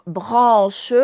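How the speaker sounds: "branche" loud, stretched into "bran" and "che"; syllable demo. Shown as a demonstration. Teacher showing pupils the first syllable, stretched demo. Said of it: "première syllabe".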